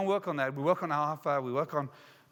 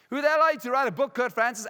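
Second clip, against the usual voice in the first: high, forceful mock accusation; acted scornful demand, pitched up; indignant challenge; 5 semitones higher, 4 dB louder than usual. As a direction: challenge yourself as an accuser would. Plays the challenging inner voice.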